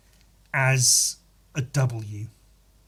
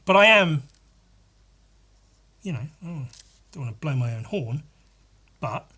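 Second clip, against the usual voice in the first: usual speaking voice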